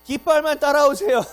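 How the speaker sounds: high, loud guide shout; a guide calling out to the group; mock tour guide. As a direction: herd tourists behind the flag.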